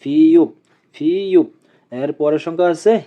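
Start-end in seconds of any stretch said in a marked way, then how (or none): 0.0-1.5 ফিউপ starting on ফ, closing on প; pronunciation demo. Shown as a demonstration. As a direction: model ফিউপ as a separate word, twice. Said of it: "উচ্চারণ হবে ফ এবং প এর মতো". (ফিউপ: ফ দিয়ে শুরু, প দিয়ে শেষ)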